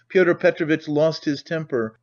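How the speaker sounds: plain reading voice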